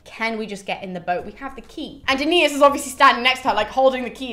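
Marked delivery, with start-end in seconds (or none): 0.0-2.0 chirpy Sibyl. Retold or imitated. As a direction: sweet-talk the ferryman onto the boat. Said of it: "the Sibyl just goes".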